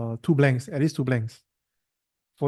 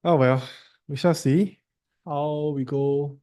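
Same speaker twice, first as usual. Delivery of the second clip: ordinary talk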